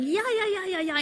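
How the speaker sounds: high, lively chorus of yeahs; grandma's eager yeahs. Retold or imitated. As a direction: imitate grandma nodding along, high. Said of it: "in Chinese".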